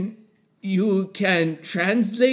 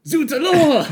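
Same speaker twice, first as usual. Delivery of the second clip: high, loud flourish of the name; dramatic exclamation; 9 semitones higher, 5 dB louder than usual. Acted. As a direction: cry it out like a stage villain.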